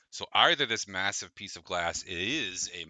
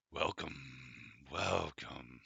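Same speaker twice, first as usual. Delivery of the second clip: low, soft, rumbling dragon; 3 semitones lower, 5 dB quieter than usual. Acted. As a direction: rumble it like a drowsy old dragon. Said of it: "The dragon rumbles, uh, deeply".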